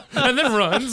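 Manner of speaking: high-pitched, laughing through the words; helpless amused delight; real laughter. Real, not acted. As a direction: squeal it out while cracking up. Everyone cracking up at imagined scene.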